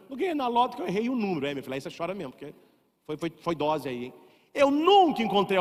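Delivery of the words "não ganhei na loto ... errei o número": mock fast whining. Mocking a lottery loser.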